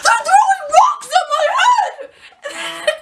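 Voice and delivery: slow, high mock shriek; hammed-up loud outrage; screaming dad. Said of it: "He's like".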